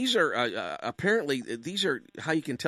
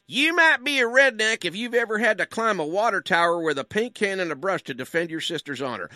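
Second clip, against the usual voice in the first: Jeff Foxworthy; 7 semitones higher, 5 dB louder than usual. Retold or imitated. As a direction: belt out the setup like a Southern stand-up. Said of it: "familiar with Jeff Foxworthy's humor".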